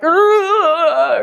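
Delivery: loud, high mock sob groan; a hammed-up ugly-cry wail. Shown as a demonstration. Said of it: "weep is ugly cry".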